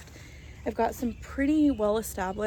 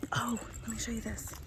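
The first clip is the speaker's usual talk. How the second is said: whispered, much softer; 8 dB quieter than usual. Real, not acted. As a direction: lean in and whisper it. Sudden whisper in the garden.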